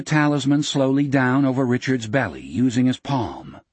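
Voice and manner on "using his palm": ordinary delivery, nothing marked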